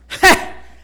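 a short loud voiceless chuckle; breathy laugh. Retold or imitated. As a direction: laugh it off happily. [name] laughs at her own story.

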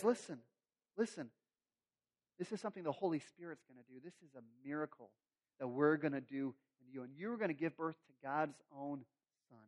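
soft, low, reassuring angel; calm her down gently; soothing angel. Acted. Angel reassuring frightened Mary, soft and low.

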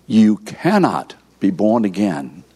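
slow, with cannot leaned on hard; stress on cannot. Shown as a demonstration. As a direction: press down on cannot, slowly. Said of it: "underline the word cannot".

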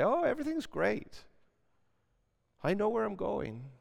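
hammed-up churchgoer's fake cheer; preacher mocks fake cheerful churchgoers, high and bright; mock happy clappy. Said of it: "Don't try to be happy clappy".

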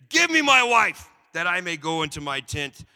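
loud, high demand for his bride; mock impatient demand. Jacob demanding his wife.

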